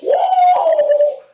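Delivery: high, loud drawn-out whoa; excited whoop. Acted. Family at a pile of presents, whooping with excitement. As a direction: whoop it out like a thrilled crowd. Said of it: "everybody's excited, like".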